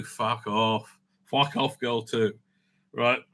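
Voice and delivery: usual speaking voice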